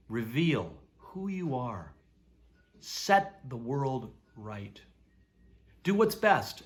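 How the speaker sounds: usual speaking voice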